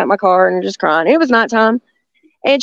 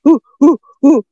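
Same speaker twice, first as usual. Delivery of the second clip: loud mock ape hoots; short loud "ooh" hoots; Bigfoot whoops; 4 semitones higher, 5 dB louder than usual. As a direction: hoot it out like a charging Bigfoot. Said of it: "he had his arms up like".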